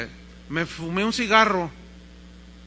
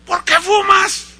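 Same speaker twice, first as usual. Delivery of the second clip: slow, high shout; acted scolding dad; angry father; 13 semitones higher, 11 dB louder, slower than usual. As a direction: bellow the accusation at your son.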